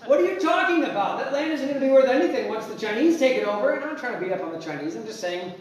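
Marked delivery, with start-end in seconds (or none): 0.1-3.7 loud, high, fast outraged refusal; shouting no at a pushy seller; incredulous shout. Retold or imitated. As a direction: yell it like the offer is absurd. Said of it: "And you're like".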